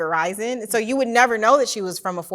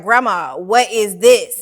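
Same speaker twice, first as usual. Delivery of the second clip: loud, slow, puzzled asking; drawn-out confused "what is this"; baffled child question; 2 semitones higher, 10 dB louder, slower than usual. Retold or imitated. Child asks grandma about the washboard, loud and slow. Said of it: "And I was like".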